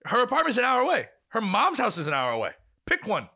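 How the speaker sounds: high-pitched, insistent retold orders; exasperated, each point punched out high; mean-sounding exasperation. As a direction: lay out the obvious in disbelief. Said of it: "I sound like I'm a really mean person".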